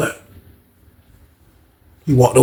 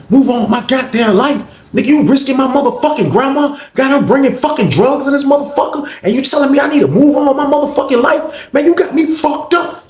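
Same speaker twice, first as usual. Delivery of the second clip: high, louder furious outburst; angry; 14 semitones higher, 4 dB louder than usual. Retold or imitated. Grandson yells at Terrence for risking his grandma. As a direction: let it rip like a wronged grandson.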